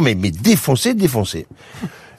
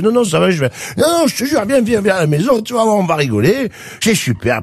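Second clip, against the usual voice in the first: much higher, slurring, overexcited wasted man; stoned drunk guy; 7 semitones higher than usual. Acted. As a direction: invite your friend home, totally wasted.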